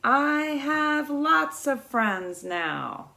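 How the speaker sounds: slow, drawn-out echoing reply. Acted. The echo answering little beaver from across the pond, slow. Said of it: "a voice answered him".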